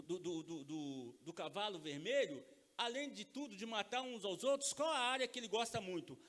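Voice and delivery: normal talking voice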